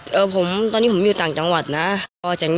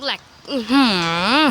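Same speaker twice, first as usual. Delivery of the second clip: drawn-out groan; 5 semitones higher, much slower than usual. Real, not acted. Lag frustration groan.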